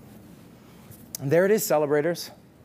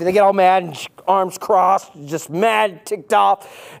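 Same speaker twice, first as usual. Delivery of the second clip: ordinary delivery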